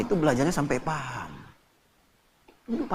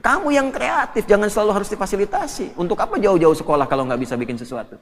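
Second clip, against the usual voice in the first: ordinary talk, nothing marked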